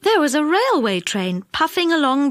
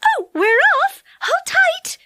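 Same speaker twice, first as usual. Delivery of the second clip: excited; 4 dB louder than usual. Acted. Silky as ride starts.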